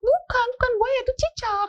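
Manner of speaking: put-on high child voice correcting mom; little child. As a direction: squeak it like a kid insisting. Small child correcting mother.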